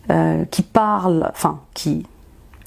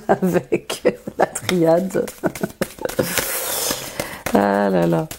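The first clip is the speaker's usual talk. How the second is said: laughing; slower than usual. Real, not acted. Laughing at her own hoarseness.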